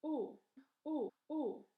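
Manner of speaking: "o" as long OH; teacher's repeated, isolated long "o"; letter sound demo. Shown as a demonstration. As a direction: sound out "o" like the vowel in boat. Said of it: "O A makes the sound".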